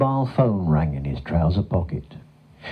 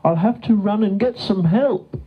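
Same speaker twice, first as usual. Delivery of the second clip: worried cow; 13 semitones higher than usual. A narrator's reading. Gertrude to her friend, off to get help, worried. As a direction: fret in a high storybook voice, stretching the last word.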